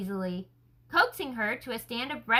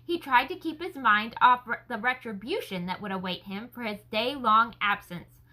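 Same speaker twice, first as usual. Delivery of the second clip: ordinary delivery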